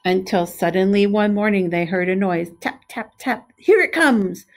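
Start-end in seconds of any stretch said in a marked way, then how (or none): 2.6-4.5 higher, louder eager shout; excited shout. A narrator's reading. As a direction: call out as the egg taps. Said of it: "shouted one of the cows".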